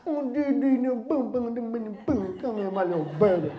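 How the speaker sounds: put-on cooing sing-song baby talk to a little one; doting baby-talk coo. Shown as a demonstration. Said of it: "You even change the way you talk".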